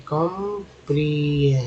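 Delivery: Comprehensive drawn out syllable by syllable; teacher slowly piecing comprehensive together; sounding out. Shown as a demonstration. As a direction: break comprehensive into slow, separate chunks. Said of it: "CS को सिप बोलते हैं".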